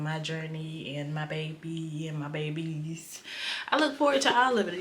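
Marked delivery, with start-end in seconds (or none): 3.7-4.8 quick, high and bright gush; a sudden excited rush of words; excited burst. Real, not acted. Excited vlogger gushing about future.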